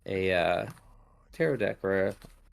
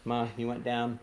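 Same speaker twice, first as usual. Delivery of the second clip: ordinary delivery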